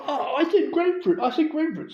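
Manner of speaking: high-pitched indignant retelling; imitating someone mad, voice high; mock mad. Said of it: "So she's mad".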